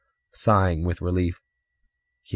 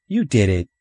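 put-on slightly raised boy's retort; accusing retort; 3 semitones higher, 4 dB louder than usual. Older brother blaming younger one, accusing retort. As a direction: throw the blame straight back. Earl throws blame back.

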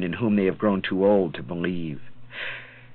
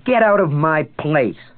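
angry woman; 6 semitones higher, 5 dB louder than usual. Acted. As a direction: throw him out of the bar. Woman orders him out.

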